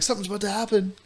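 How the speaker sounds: high, small comic voice; overwhelmed Dale imitation. Co-host quoting Dale from the movie, mock panic.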